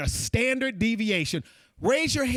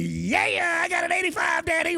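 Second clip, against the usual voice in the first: carefree son; 8 semitones higher than usual. Retold or imitated. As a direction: cheerfully yell it like a kid who doesn't care. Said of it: "he's like".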